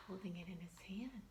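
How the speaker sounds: quick whispered aside. Real, not acted. Teacher whispers a note about the picture. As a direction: rush it out under your breath.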